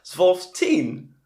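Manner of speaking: put-on "Zwölf" said with a hard Dutch "sv" sound, teen stretched; bad Dutch accent. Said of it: "bad Dutch accent".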